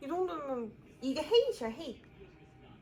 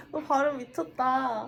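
laughing; 3 semitones higher, 13 dB louder than usual. Real, not acted. Laughing at the accent.

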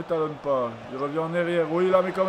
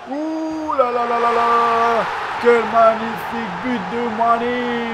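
louder, higher, slow drawn-out cry; ecstatic goal call; 5 semitones higher, 5 dB louder, slower than usual. Real, not acted. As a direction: let the goal carry you away. Celebrates Mané's goal. (cri de but extatique, plus haut, plus fort et lent)

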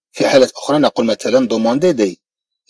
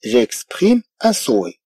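very slow, softer French; slow model reading; 4 dB quieter, much slower than usual. Shown as a demonstration. French teacher reading to students, slowly. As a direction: spell it out slowly for learners. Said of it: "أقول مثلًا".

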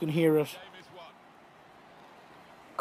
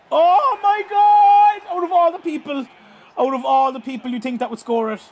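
shocked shout; 8 semitones higher, 7 dB louder than usual. Real, not acted. Unlikely scorer nets penalty.

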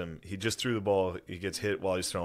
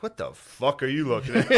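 louder, higher imagined snap at someone; playful sudden flare-up; mock spaz outburst; 5 semitones higher, 4 dB louder than usual. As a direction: act out suddenly losing it, half laughing. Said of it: "occasionally just spaz on O'Connor".

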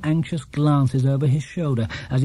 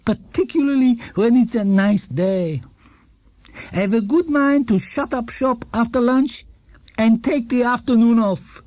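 put-on higher, gentle old man's voice; kindly old shopkeeper; 8 semitones higher than usual. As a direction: play a cheerful elderly shopkeeper. Gruber continues.

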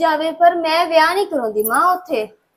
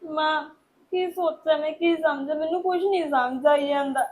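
softer, tearful voice; crying; 5 dB quieter than usual. Acted. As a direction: speak through tears. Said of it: "ਰੋ ਨਾ ਪੁੱਤ".

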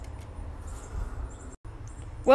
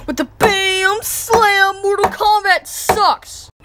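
slow, drawn-out, louder shout; slow yell; 3 semitones higher, 4 dB louder, slower than usual. Acted. Boy yelling as he slams the Wii, slow and loud. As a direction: stretch every word as you smash it.

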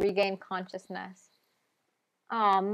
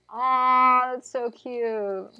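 cooing aw; 4 semitones higher, 5 dB louder, much slower than usual. Real, not acted. Cooing at tired dog.

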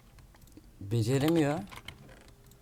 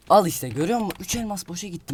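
loud, high burst of frustration; exasperated yell; 7 semitones higher, 8 dB louder than usual. Real, not acted. Gamer yelling at his viewer after losing diamonds. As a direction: shout it like the enemy just ruined everything.

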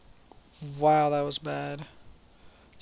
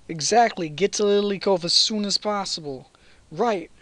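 higher, louder put-on accent; raised, accented game character voice; Professor Birch; 5 semitones higher, 8 dB louder than usual. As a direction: give orders as the accented phone caller. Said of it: "Professor Birch has his accent now".

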